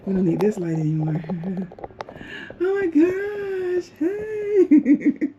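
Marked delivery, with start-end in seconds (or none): 2.6-5.3 high, stretched-out squeal breaking into laughter; thrilled squeal. Real, not acted. Traveler discovering more of her bathroom, squealing happily. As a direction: draw it out high, giddy with delight.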